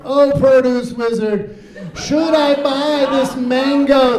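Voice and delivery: high, loud, slow adoring call with a laugh; eager fan. Retold or imitated. Fans in the grocery store calling out to the produce wizard. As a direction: gush at the wizard like a starstruck fan. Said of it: "they say, like".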